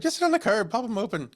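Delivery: high-pitched imitated guide; Irish tour guide. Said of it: "and he's like".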